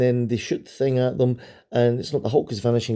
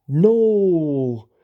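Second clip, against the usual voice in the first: excited no; 6 semitones higher, 6 dB louder than usual. Real, not acted. Reacting to comic fight.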